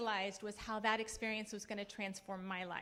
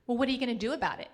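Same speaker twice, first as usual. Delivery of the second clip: put-on louder, fast, a teen's blunt challenge; challenging daughter; 8 dB louder, much faster than usual. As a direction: fire the question back like a pushy teenager. Daughter challenges her in story.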